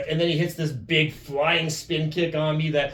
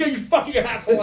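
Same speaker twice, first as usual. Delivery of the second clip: high, loud retold curse; mock outraged cursing; 11 semitones higher, 8 dB louder than usual. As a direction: curse the prankster in comic outrage. Acted curse at prankster.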